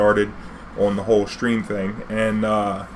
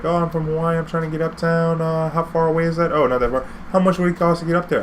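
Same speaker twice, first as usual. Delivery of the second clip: lightly voiced young woman, higher; a hint of a chirpy tourist girl; girl from Hawaii; 6 semitones higher than usual. Tourist girl asks the cab driver about fare, lightly voiced and higher. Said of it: "She's like".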